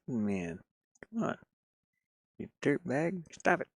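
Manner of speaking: ordinary talk, nothing marked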